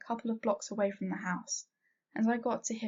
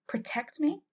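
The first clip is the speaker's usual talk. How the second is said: higher, incredulous snap; snapping; 6 semitones higher, 3 dB louder than usual. A narrator's reading. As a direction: throw the word back at her. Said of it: "I snapped".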